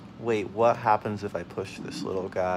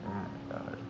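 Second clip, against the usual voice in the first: whispered; 3 semitones lower, 15 dB quieter than usual. Real, not acted. In-game shock, breath only.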